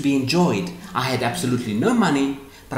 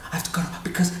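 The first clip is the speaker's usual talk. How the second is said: whisper; 5 semitones higher, 3 dB quieter, much faster than usual. Retold or imitated. Voicing anxious clinging thought.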